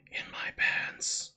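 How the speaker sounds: whispered punchline, slow. Acted. Joking Yoda quote whispered as a punchline. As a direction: slip the joke in under your breath. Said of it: "You must feel the Force".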